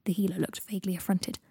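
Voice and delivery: plain reading voice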